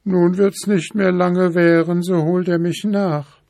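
high lamenting princess voice; wailing princess. A narrator's reading. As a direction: lament the devil's spell. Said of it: "jammerte die Prinzessin". (hohe, jammernde Prinzessinnenstimme)

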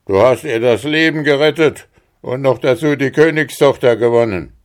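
lauter Ruf des Riesen. Acted. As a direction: der besiegte Riese ruft es aus. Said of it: "Da rief der Riese". (lauter Ruf des Riesen)

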